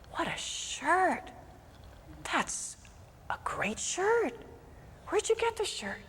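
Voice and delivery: put-on high, hushed, slowed drunk admiring the shirt; town drunk. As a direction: ogle the shirt like a sly drunk.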